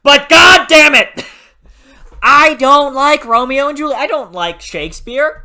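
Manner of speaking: loud, high-pitched ranting shout; exasperated outburst. Real, not acted. Reviewer rants at Aaron about Shakespeare, exasperated shouting. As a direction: burst out yelling in exasperation.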